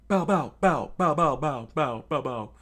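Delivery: high, loud bow bow bow, fading; cannon firing sounds. Acted. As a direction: imitate a gun shooting balls. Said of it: "Lasso starts going".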